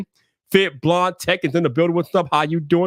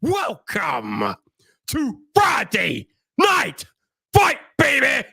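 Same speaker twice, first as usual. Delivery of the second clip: high, shouted announcer intro with stretched-out words; hype show opening; 14 semitones higher, much slower than usual. Acted. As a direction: belt out a live show welcome with long whoops. Performs the show's opening.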